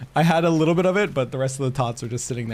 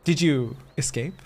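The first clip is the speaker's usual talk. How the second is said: ordinary talk, nothing marked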